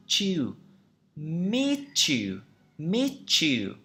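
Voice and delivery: meet you blended into "mee-chew"; slowly joined "mee-chew" for meet you; linked sounds. Shown as a demonstration. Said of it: "nó sẽ trở thành âm ch".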